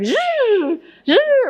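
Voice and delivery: high-pitched zooming noise; two high mock motor zips; imitated Segway whir. Describing a Segway ride, high imitated whir. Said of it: "it's like".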